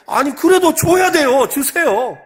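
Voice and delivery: put-on high, loud, slow demanding kid; shameless child. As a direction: insist stubbornly, stretching the words. (떼쓰는 아이처럼 높고 느리게 조르는)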